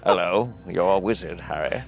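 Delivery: put-on deep, fading old man voice; low croaky old wizard. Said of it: "No, it's not good".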